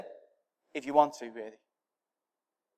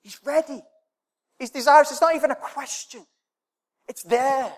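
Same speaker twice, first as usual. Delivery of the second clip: excited; 8 semitones higher than usual. Real, not acted. Preacher building excitement.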